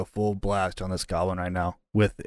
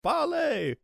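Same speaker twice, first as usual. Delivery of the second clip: high desperate shriek. Acted. Prisoner shrieking at the goblin, high. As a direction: squeal the word out.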